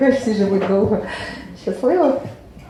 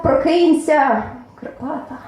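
crying out; 6 semitones higher, slower than usual. A narrator's reading. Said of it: "білішого білий кричав".

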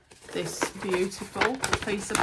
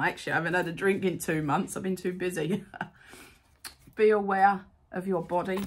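ordinary talk, nothing marked

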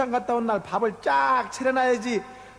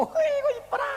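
delighted gush. Acted. Husband ogles actress on TV.

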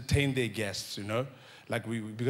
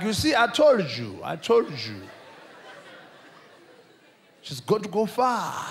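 higher, louder bragging mom; proud mother; 5 semitones higher, 3 dB louder than usual. Retold or imitated. Mother bragging about daughter to guests, higher proud boast. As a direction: crow with pride to the guests. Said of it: "And be like".

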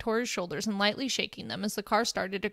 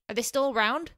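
screeching; 3 semitones higher, 4 dB louder than usual. Acted. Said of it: "Hagakure screeched".

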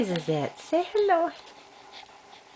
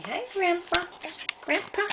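put-on high baby voice; 6 semitones higher than usual.

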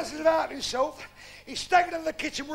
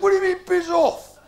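loud, high parade-ground protest; barked military indignation, hammed up; affronted mock guardsman; 5 semitones higher, 8 dB louder than usual. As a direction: question the refusal like a drill sergeant. Guardsman customer queries being refused.

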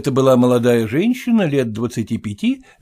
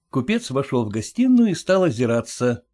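ordinary delivery, nothing marked

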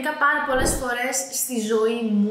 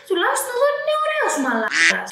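high, loud mocking tease; teasing; 10 semitones higher, 4 dB louder than usual. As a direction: push it up high to rib him. Teasing friend about photo.

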